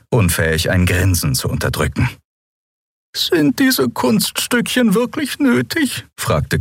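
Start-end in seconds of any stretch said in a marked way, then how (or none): 3.1-6.1 much higher, slow and aloof; cool disapproving Xea. Acted. Xea rebuking Endriel for her showing off, high and aloof. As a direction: ask it with lofty disdain. Xea's voice, unlike narration.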